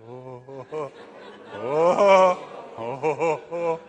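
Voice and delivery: loud startled oh's while losing balance; mock panic cries on the rope; wobbly gasps. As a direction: gasp like you're tipping off a tightrope. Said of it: "wobbling on pretend tightrope".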